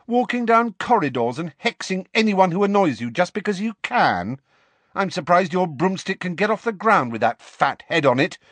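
high-pitched, furious rant; shouted tirade. A narrator's reading. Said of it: "Lily shouted".

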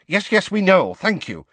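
high, quick cutting-in; swift. A narrator's reading. Tonks interrupting the conductor's welcome, swiftly. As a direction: cut in swiftly to shut someone up. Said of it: "said Tonks swiftly".

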